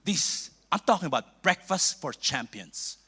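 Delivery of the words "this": ordinary delivery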